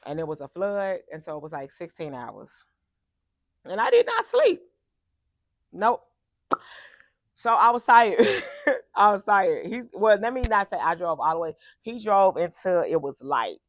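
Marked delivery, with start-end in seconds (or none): 3.6-9.7 exasperated exclaim. Real, not acted.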